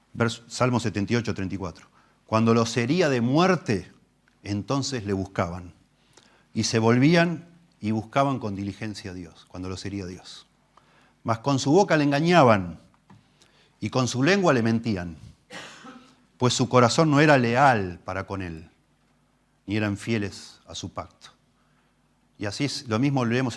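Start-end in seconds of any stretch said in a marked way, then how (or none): 2.2-9.4 solemn scripture reading. Acted. Reading a psalm aloud. 11.2-21.3 solemn scripture reading. Acted. Reading a psalm aloud.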